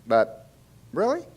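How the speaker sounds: high, rising incredulous query; skeptical question. Real, not acted. Lecturer challenging what doctors tell their patients, skeptical. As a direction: question it with a sharp upward lift.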